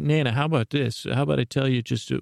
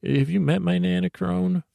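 higher granny voice; Nana's voice; 7 semitones higher than usual. Retold or imitated. Grandma Nana introducing herself to the Crone in a higher granny voice. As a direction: pipe up like a little old grandma. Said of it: "and Nana's like".

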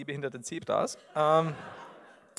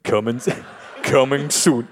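movie trailer voice; 4 semitones lower, 6 dB louder, slower than usual. Acted. Director's Cut.

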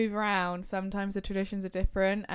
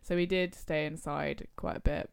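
ordinary talk, nothing marked

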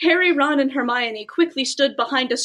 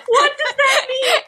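high laughing question; laughing disbelief; 10 semitones higher than usual. Real, not acted. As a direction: laugh through the confusion. Confused by bot text.

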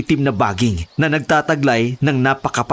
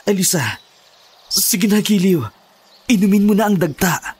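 higher pleading coax; pleading urging; 6 semitones higher than usual. Acted. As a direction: coax her gently, pitched up. Husband urging wife to drink.

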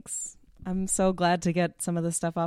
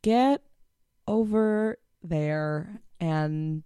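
slow deliberate motto; 3 semitones higher, much slower than usual. Shown as a demonstration. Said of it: "words to live by".

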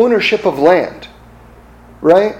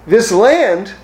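voice of God; 8 semitones higher than usual. Retold or imitated.

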